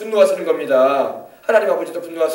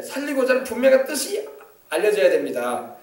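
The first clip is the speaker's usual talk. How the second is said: ordinary talk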